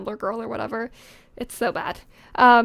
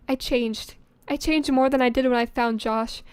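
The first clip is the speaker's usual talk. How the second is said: normal talking voice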